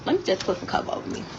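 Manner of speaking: quick hushed aside while fixing the covers; a rushed, breathy aside off-mic; whispered aside. Real, not acted. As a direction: drop to a fast whisper, talking to yourself. Aside while fixing bed on live.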